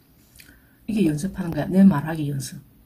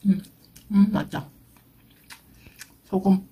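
words muffled by food; chewing while talking. Real, not acted. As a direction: mouth half closed. Seen 1: Pursed, working lips and moving cheeks throughout the clip, mukbang eating.

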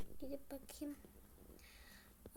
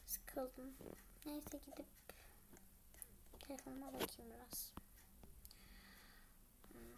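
quick unvoiced whisper; bored whisper; 3 semitones lower, 14 dB quieter than usual. Waiting for Kemal, bored whisper. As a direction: mutter it under your breath fast.

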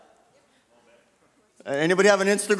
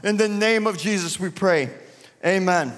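fervent prayer; 3 semitones higher than usual. Acted. Pastor leading the standing church in prayer, fervent and earnest. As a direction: pray aloud for the city with rising fervor.

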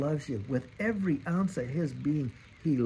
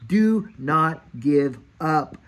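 loud, slow and drawn out; a preacher's forceful appeal; emphatic plea; 3 semitones higher, 9 dB louder, much slower than usual. Real, not acted. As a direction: plead with them not to quit. Preacher urging listeners on.

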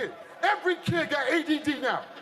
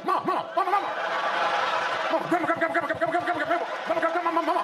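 put-on high, excited kid chanting grandma nonstop; wired child calling over and over; hyper kid calling; 5 semitones higher, much faster than usual. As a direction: play a sugared-up grandkid pestering.

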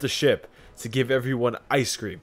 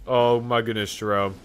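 normal talking voice

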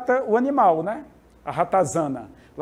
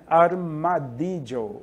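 slow demo word; 3 semitones lower, much slower than usual. Shown as a demonstration. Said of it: "o armadilho, o tatu".

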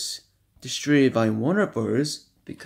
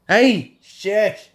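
loud, higher hushing call; 8 semitones higher, 11 dB louder than usual. Real, not acted. Telling the noisy kids to hush, a loud shout.

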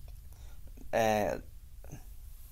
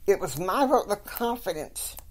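stern; 8 semitones higher than usual. Retold or imitated. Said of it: "Betsy gets kinda stern".

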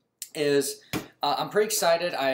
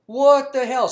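exasperated surprise; 8 semitones higher, 6 dB louder, slower than usual. Retold or imitated. Thinking at declined card.

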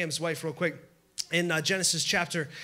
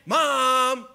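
loud whining call, higher pitch; 4 semitones higher, 6 dB louder than usual. Retold or imitated. Preacher playing a child calling for mom, loud high whining call. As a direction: yell it like a kid tattling.

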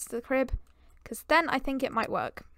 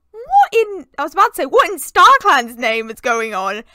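loud exclamation; 12 dB louder than usual. Real, not acted. Shocked at dogs' woohoo.

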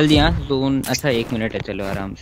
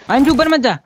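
urgent yell; 10 semitones higher, 4 dB louder than usual. Real, not acted. Stopping teammate from going up.

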